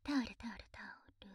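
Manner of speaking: whispering. Acted. Hurried aside to fetch a towel.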